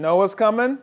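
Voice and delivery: high, loud surprised rise on coming; surprised question. Real, not acted. Dad surprised that Noah is coming with the ladder, high loud question. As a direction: caught off guard, asking back.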